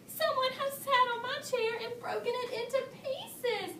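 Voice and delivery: a little bear's hammed-up lament; wailing. A narrator's reading. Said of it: "he wailed".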